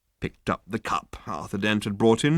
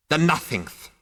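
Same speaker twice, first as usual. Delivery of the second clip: spat; 7 semitones higher than usual. A narrator's reading. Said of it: "spat the figure".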